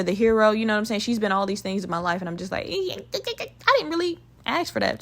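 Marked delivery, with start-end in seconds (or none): 2.7-5.0 high, softened reluctant squeak; mock-uneasy, high and quiet; reluctant protest. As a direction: back away from it in a small high voice. Voicing her reluctance.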